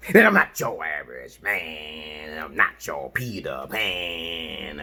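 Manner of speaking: slow, low, drawn-out chant; slow rhyming boast. Acted. Telling viewers he is not your average man, slow and low. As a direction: let each word hang, slow and low.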